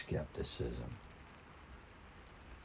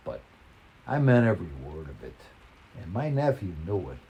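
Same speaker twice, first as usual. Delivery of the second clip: ordinary delivery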